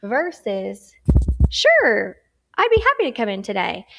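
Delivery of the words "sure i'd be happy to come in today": much louder, fast, upbeat sure; eager and confident; positive and confident. Shown as a demonstration. Teacher demos an eager, confident reply to the boss. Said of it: "You sound positive and confident".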